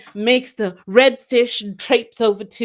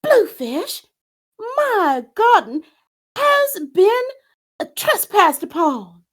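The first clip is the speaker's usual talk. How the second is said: hammed-up wail; 12 semitones higher than usual. Redfish wailing over berries.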